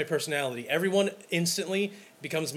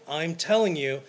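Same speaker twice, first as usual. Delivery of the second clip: usual speaking voice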